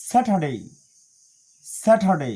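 "স্যাটারডে" as SA-tuh-day, R silent; loud "স্যাটারডে" with aspirated T and no R; correct British pronunciation. Shown as a demonstration. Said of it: "সঠিক উচ্চারণ".

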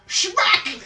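high, loud comic smack noise; cartoon smack sound. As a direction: punch out the sound effect way up high. Punchline of his joke.

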